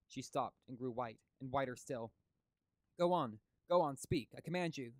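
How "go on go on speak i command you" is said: much higher and louder, desperate pleading order; agonized command. Acted. Professor urging the entranced woman to speak, agonized cry. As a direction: beg her on in anguish, almost shouting. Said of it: "said Van Helsing in an agonized voice".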